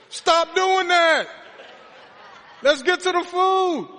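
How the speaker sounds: high, loud, drawn-out pleading; exasperated shout. Acted. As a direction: holler at them in comic exasperation. Mock-scolding long-winded pray-ers at dinner.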